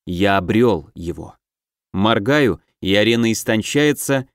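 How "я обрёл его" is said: steady, certain declaration; firm and confident. Acted. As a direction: say it with calm resolve. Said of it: "Мой голос звучит твёрдо и уверенно".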